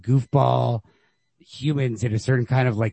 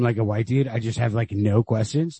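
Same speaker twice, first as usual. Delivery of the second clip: ordinary delivery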